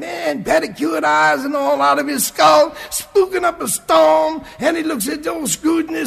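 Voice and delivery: wide-eyed storyteller shouting the arrival; excited telling. A narrator's reading. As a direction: paint the giant spook in a rising shout. Big spook arrives, excited telling.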